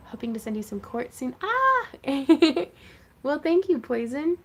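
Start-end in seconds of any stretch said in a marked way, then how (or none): 1.4-1.9 sudden high delighted yelp; excited yelp. Real, not acted. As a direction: yelp with happy surprise. Viewer offers to send quartz.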